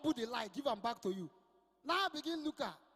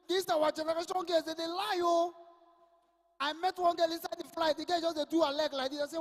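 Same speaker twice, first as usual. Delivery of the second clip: ordinary talk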